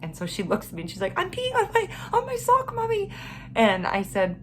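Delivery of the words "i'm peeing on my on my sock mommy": put-on little girl voice. Mother voices daughter in distress.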